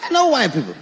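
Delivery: ordinary talk, nothing marked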